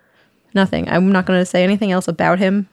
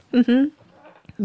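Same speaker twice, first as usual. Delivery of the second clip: ordinary talk, nothing marked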